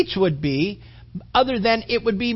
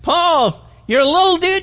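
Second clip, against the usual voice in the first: mocking taunt; 9 semitones higher, 4 dB louder than usual. Mocking crowd taunting Paul.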